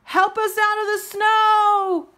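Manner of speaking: very high, loud, crying plea for help; tearful high shouting plea; frightened shout. A narrator's reading. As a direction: beg to be rescued from the snow. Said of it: "now shouted out".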